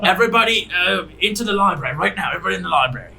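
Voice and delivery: a ship captain rallying the crew in alarm; commanding shout. Acted. Captain orders everyone to library.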